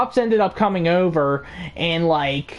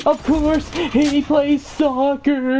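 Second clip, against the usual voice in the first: high, slow whiny brat yelling; bratty kid shout; 7 semitones higher, slower than usual. Retold or imitated. Bratty kid insisting he's the greatest, high whiny shout. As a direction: shout back like a spoiled kid. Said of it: "I'm like".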